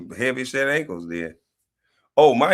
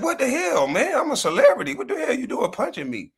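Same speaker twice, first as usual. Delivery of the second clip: mocking [name]; 13 semitones higher than usual. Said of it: "You see how [name] was mad?".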